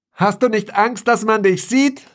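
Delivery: high, strained shout over a roar; shouting over wind. A narrator's reading. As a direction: call out against the wind. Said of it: "brüllte ich gegen das Brummen".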